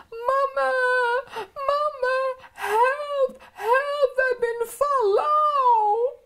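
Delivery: put-on high wailing little children calling for help; crying for mama. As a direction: wail for mama as if fallen and hurt. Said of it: "Ze reren en ze roepen van".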